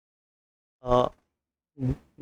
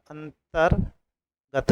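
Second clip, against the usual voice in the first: slow spelled-out word; much slower than usual. Shown as a demonstration. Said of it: "तो रा ता पे आएगा".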